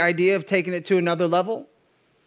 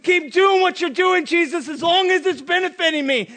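demanding crowd; 8 semitones higher than usual. Acted. Crowd demanding more benefits.

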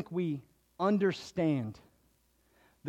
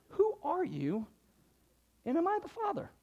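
baffled parent; 9 semitones higher, 6 dB quieter than usual. Retold or imitated. Parent baffled by own kid.

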